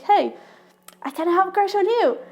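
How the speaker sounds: crush confessing. Pretend. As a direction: play someone admitting a crush.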